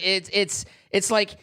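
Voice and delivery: ordinary talk, nothing marked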